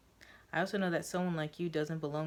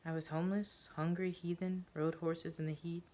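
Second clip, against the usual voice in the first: ordinary delivery, nothing marked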